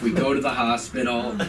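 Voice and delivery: ordinary talk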